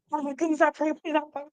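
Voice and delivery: high, soft mock prayer; lightly hammed-up anxious pleading; mock pleading worrier. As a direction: imitate someone fretting and begging. Mocking a worrier.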